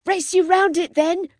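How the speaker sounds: high, loud, gleeful challenge; excited cry. A narrator's reading. Freddy challenges the others to a race, loud gleeful cry. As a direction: shout it out like a boy racing his friends. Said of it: "cried Freddy".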